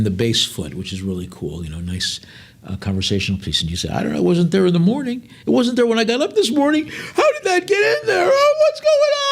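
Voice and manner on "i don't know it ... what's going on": rising to high, shrill mock panic; mock panicked host. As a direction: play the flustered host getting more alarmed. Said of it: "have some fun with your guests".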